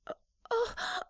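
ordinary talk, nothing marked

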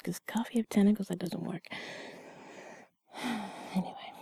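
ordinary talk, nothing marked

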